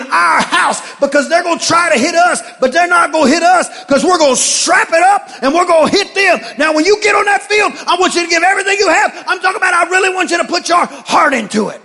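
shouting pep-talk coach voice; barking gym coach. Acted. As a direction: fire up the team like a coach. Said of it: "the football coach voice".

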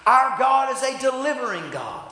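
rising, loud sermon cadence; fiery preacher building to a shout; preaching. Real, not acted. Preaching at full force.

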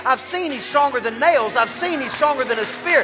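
building from plain to loud, high preaching; preaching at full force. Acted. Preacher building the congregation to a peak, rising and loud.

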